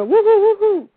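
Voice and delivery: high, loud run of woos; mock whooping. Caller acting out their homecoming, mock whooping. Mimes excitement while telling own story.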